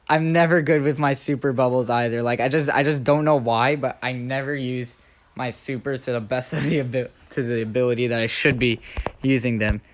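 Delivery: ordinary talk, nothing marked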